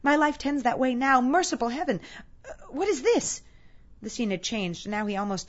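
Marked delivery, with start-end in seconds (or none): 0.0-3.4 high, agitated pleading in horror; horrified Scrooge. Acted. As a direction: gasp out the dawning realization, voice raised high. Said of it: "listened to this dialogue in horror".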